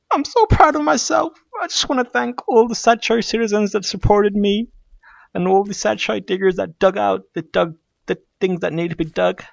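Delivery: high, mock-tearful thank-you speech; hammed-up proud, welling up; choked-up emotional. Gamer thanking his supporters, mock emotional. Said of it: "I'm feeling emotional right now".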